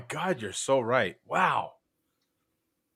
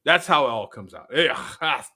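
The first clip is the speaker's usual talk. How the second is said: ordinary talk, nothing marked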